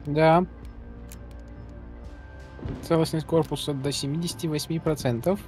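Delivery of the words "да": ordinary talk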